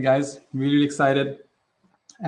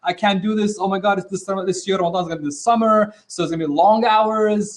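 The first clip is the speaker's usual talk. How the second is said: higher-pitched, panicked rush of complaints; mock panic; 4 semitones higher than usual. Teacher imitating people dreading Ramadan's arrival, mock panic. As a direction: act out someone panicking, voice raised in pitch. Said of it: "like, a panic".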